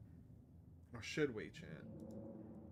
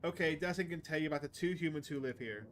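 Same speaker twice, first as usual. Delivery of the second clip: ordinary talk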